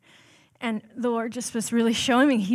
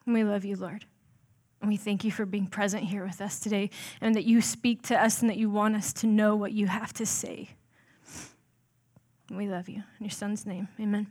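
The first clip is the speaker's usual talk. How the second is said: earnest prayer; 3 dB quieter than usual. Real, not acted. Pastor leads the congregation in prayer, soft and earnest. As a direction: pray quietly and sincerely, unhurried.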